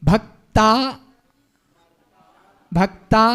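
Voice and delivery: भक्ता cut off before मर; भक्ता said alone as if the word ends there; wrong split bhakta. Shown as a demonstration. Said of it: "भक्ता जो बोल रहे हैं ये गलत है". (भक्ता को अलग तोड़कर गलत बोलना)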